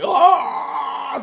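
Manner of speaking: long, high, loud mock wail; mock horrified scream. Mimicking diners told there's no impeachment, mock screaming. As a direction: scream in exaggerated horror, stretched out.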